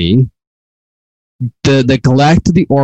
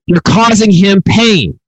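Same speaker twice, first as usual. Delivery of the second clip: higher, drawn-out emphatic mock yell; mild yell; 6 semitones higher, slower than usual. Preacher acting out God yelling again, mild slow yell. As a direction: stretch each word out in a raised voice. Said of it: "And so he yells again".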